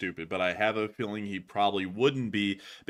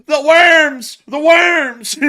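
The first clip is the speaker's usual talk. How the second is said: screeching the worms, much higher and louder; imitating the worm guy; 16 dB louder, much slower than usual. To his co-host, mock shrieking the clip's worm line. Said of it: "where he goes".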